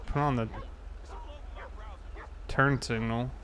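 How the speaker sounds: ordinary talk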